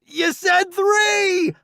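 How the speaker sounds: high slow indignant yell; protesting. Acted. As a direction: complain loudly chasing him. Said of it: "I protest".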